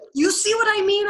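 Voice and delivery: a shrill I-told-you-so; Clevenger insisting. Acted. Clevenger vindicated again.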